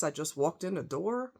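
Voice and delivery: ordinary talk, nothing marked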